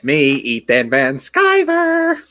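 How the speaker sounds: over-the-top camp vlogger intro, high and loud; hammed-up flamboyant greeting, stretching out the name; Ethan Van Sciver. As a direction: sing out the intro, far too camp. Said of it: "Gotta add a little bit of gayness".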